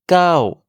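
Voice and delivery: "Kao" starting high, then falling low. Shown as a demonstration. Teacher modelling Thai nine, falling "Kao". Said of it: "démarre dans les aigus pour descendre vers les graves".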